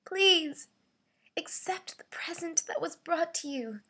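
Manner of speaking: high, beseeching plea; pleading, weakly. Shown as a demonstration. Voice actor begging someone to accept a gift, pleading weakly. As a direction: beg them in a high, weak voice. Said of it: "This is my pleading slash weekly read".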